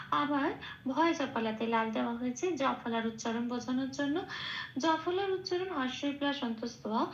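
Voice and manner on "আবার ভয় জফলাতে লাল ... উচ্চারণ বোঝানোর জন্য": ordinary talk, nothing marked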